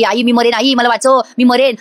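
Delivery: ordinary delivery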